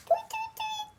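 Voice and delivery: very high tiny chirping tweets; bird tweets. Acted. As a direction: peep it like a little bird. Bird noises in read-aloud story.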